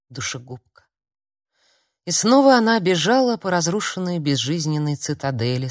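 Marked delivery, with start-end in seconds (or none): 0.1-0.8 very soft, haunted thought; inner voice. A narrator's reading. Self-accusation returns to collapsed girl, hushed. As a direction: sound it faintly inside the head. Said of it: "билась в голове".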